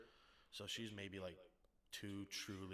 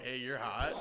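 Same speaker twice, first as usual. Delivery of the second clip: louder amazed quote; 3 semitones higher, 11 dB louder than usual. Retold or imitated.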